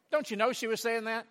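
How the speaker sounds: ordinary talk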